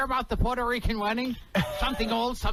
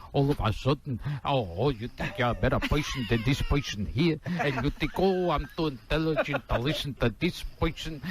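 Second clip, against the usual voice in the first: lower, kvetching Borscht Belt rant; a lower, indignant old-school comic; Jackie Mason impression; 6 semitones lower than usual. Comic doing Jackie Mason at the unamused guest, lower and ranting. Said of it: "He does Jackie Mason".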